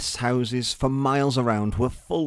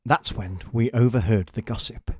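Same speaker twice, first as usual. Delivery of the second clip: plain reading voice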